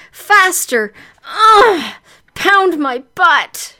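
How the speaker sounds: high panting, building to loud cries; aroused heroine. Acted. Heroine urging her lover on, loud and breathless.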